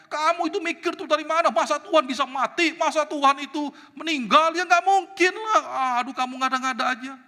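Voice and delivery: high, loud exasperated tirade; acted-out furious rebuke; Martin Luther's scolding. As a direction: tell the wife off in disbelief. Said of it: "Wah, dia marahin".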